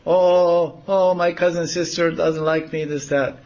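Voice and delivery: high, wailing cousin's complaint, lightly acted; mock crying lament. Krishna disguised as Vidyavali complains, mock crying, high and wailing. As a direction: whine it like a fake tearful girl. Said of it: "she starts falling on the ground crying".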